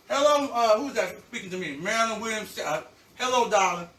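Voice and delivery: talking with a mouth full; words round a bite of food. Real, not acted. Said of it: "'cause my mouth full right now".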